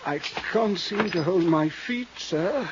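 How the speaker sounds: faint, halting words of a shot man; dying groans. Acted. Shot old thief pleading with the detective, fading. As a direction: gasp it out weakly, fading.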